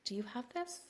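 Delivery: hushed, wavering mock doubt; doubtful helper. Unhelpful birth attendant to a labouring mother, mock doubtful. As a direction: play someone unsure you can cope. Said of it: "versus somebody who's been like".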